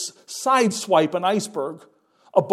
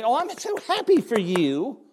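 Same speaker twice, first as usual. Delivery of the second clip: high, airy fake congratulation; a sing-song sarcastic happy-for-you; mock worldly well-wisher; 6 semitones higher than usual. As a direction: mimic a phony friend's sweet cheer. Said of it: "don't expect the world to say".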